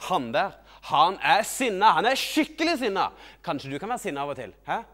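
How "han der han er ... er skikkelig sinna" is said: loud, rising play-angry voice; mock anger. Showing children the angry figure, mock anger. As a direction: build the anger louder and higher.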